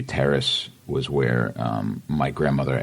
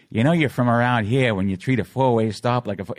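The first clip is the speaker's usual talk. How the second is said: higher-pitched smiling Boston ad read; smiling delivery; 7 semitones higher, 3 dB louder than usual. Retold or imitated. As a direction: smile through the Boston commercial line. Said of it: "Try smiling when you deliver it".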